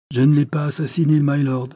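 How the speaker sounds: plain reading voice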